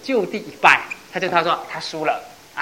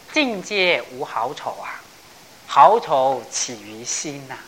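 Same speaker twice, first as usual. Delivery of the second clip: slow verse recitation; 4 semitones lower, slower than usual. Shown as a demonstration. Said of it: "reciting a verse slowly".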